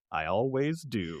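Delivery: slow, softened mock-seductive line; mock sexy. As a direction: draw it out like a dorky seducer. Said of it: "he says it so sexy".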